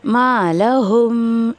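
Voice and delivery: मा लहुम recited very slowly with the म hummed; slow nasal recitation of मा लहुम; ghunna recitation. Shown as a demonstration. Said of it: "इस तरीके से".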